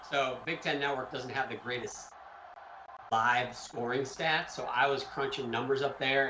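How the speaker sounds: ordinary talk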